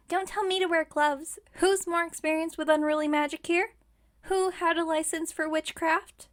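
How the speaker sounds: indignant retort. Acted. Witch defends her expertise to a customer, indignant. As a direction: pull rank, offended and forceful. Witch bristles at advice on gloves.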